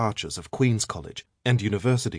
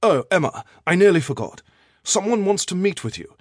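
raised, higher-pitched professor's voice; louder and higher, as a sudden afterthought; calling after her; 9 semitones higher, 7 dB louder than usual. A narrator's reading. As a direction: call out to someone who is leaving. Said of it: "Professor Harrington called after her".

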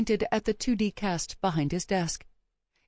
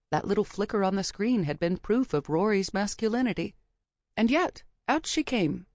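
usual speaking voice

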